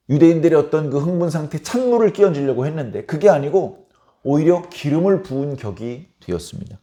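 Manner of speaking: normal talking voice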